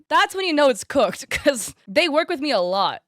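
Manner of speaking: laughing. Real, not acted. Laughing disbelief about agent.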